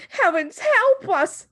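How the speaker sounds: very high, loud slow cry; wife clutching husband, terrified cry. Acted. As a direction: beg heaven in terror. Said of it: "cried the mother".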